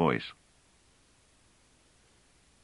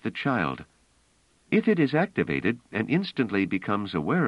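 higher voice, pressing her point; a woman insisting on the plan; Bliss, urgent and intent; 7 semitones higher than usual. Acted. Bliss urging Pellerat to play robots, earnest. Bliss refusing Trevise firmly.

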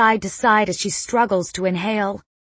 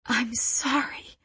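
soft, slow, higher-pitched tearful apology; hushed, weepy plea for forgiveness; sobbing whisper; 4 semitones higher, 7 dB quieter, slower than usual. A narrator's reading. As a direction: whisper it through tears. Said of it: "I whisper, sobbing".

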